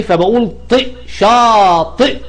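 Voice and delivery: شاطئ said slowly, ط then ي then hamza; slow model word. Shown as a demonstration. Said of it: "ط وبعدين يه والهمزة فوق اليه".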